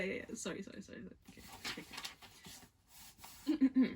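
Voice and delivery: normal talking voice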